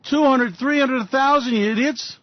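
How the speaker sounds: mock demand. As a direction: shout out ever-bigger numbers to taunt. Ranting, demanding sky-high oil prices.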